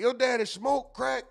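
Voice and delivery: imitating the guy from the clip; mocking the guy. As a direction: parrot his insult back with a sneer.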